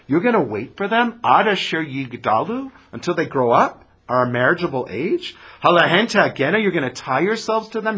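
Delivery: a hint of exasperated old woman; Naomi, incredulous. Voices Naomi mocking the idea.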